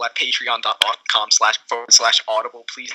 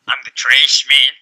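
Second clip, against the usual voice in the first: slow, louder put-on trash man; 8 dB louder, slower than usual. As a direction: ham up the trash man slowly. Doing a joke character voice.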